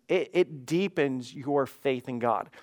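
slowed right down, leaning on each word; slow emphatic repeat. Real, not acted. Pastor driving the first point home to listeners, slow. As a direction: let it sink in slowly.

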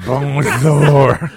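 mock French accent; hammy French bonjour. Joking French snail lady.

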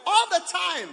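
high, loud quoted command; loud preacher's rallying cry; preaching shout. Acted. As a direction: shout Paul's charge from the pulpit. Quoting Paul's charge, shouting.

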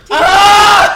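high, loud cry of pain; pained shout. Real, not acted. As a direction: yell out from the heat. After losing the point.

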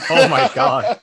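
loud, high, laughing exclamation; real delighted amazement. Real, not acted. Game master reacting to a player's sword names, laughing amazement.

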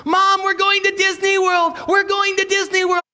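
put-on high, loud, thrilled child's cry; little girl screaming to mom about Disney World; excited little girl screaming. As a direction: shout it like a kid who just got big news. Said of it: "she goes screaming into the kitchen".

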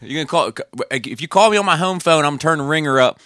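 hammed-up Maryland local; Maryland accent. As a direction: lay on the Maryland long O. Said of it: "that's a Maryland".

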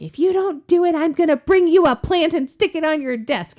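high, loud imitation of a pushy coworker; mock threat. Coworker bribing her to do the accent, mock threatening. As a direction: threaten playfully, with high-pitched insistence.

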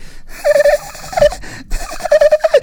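loud, very high cackle; mock hysterical giggle; imitated hysterical laughter. Friends laughing as loud as possible, high cackle. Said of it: "all laughing hysterically".

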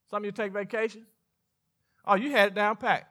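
usual speaking voice

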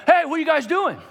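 high, loud call to passing strangers; retold yell. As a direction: holler out like a teen hailing someone. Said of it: "They yell".

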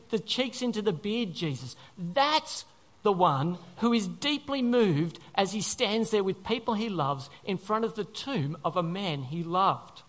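ordinary talk

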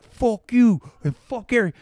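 high, loud, slow defiant yell; slow, loud drunken insult. Retold or imitated. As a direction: spit it out slowly, loud. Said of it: "And I'm like".

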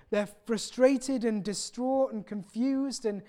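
ordinary talk

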